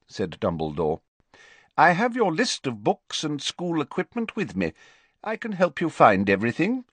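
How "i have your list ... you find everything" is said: higher, courteous offer; a hint of a kindly mentor; lightly voiced helpful headteacher. A narrator's reading. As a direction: offer guidance to a new pupil. Voices Dumbledore offering help.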